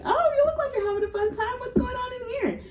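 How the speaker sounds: very high, fast, sugary mock welcome; sweet high greeting. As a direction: put on a falsetto hostess voice, quick and sly. Woman voiced greeting the visitor.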